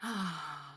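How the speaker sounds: long audible sigh out; deep exhale. Shown as a demonstration. Showing listeners a deep breath, long sighing exhale. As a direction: let a full breath go in a sigh. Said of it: "Take a few deep rounds of breath".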